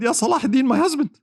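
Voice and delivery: high, pleading wife of the king; a queen asks Salah ad-Din to free the king, high and pleading. Retold or imitated. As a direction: beg for her husband's release. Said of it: "came to him and said".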